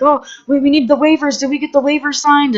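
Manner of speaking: panicky producer. Retold or imitated. Zach voiced panicking.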